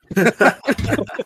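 high burst of laughter; real laugh. Real, not acted. Bartender joking to chat about daddy, laughing. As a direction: crack up at your own joke.

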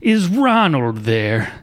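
slow, drawn-out mock caller, rising high; teen prank caller asking for Ronald in a silly voice. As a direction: stretch the name out like a dopey caller. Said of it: "And he'd be like".

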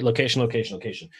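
each location falling, the last one dropping away softly; the familiar realtor mantra, location location location, trailing off; stress on location. Shown as a demonstration. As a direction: land every location as a slogan. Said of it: "Let's just take location".

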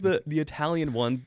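normal talking voice

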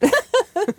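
squealing laugh; high burst of laughter. Real, not acted. Laughing at her own joke to the hosts.

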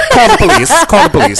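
high, loud laughing cry; horrified amusement. Acted. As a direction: laugh while crying out. Laughing joke punchline.

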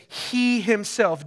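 "He himself" drawn out slow, stress on "He"; emphatic. Real, not acted. Preacher reading the verse aloud, slow heavy stress on "He himself". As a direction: lean hard on "He" in "He himself", slowly. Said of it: "Jesus, it's emphatic".

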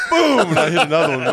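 a gleeful, laughing shout of Boom; a kid striking a fish, laughing. Retold or imitated. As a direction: burst it out with a laugh. Hitting the flounder, laughing.

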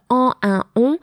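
en, un, on said as nasal French vowels; French nasals demo. Shown as a demonstration. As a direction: voice en, un, on through the nose. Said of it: "the nasals".

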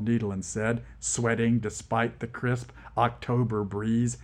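ordinary delivery

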